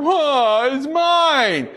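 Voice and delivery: put-on a kid whining it is his; indignant kid. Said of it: "You're like".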